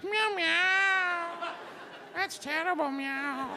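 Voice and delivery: high, mock-meowing cat. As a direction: mew it out like a put-upon cat. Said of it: "the poor cat's like".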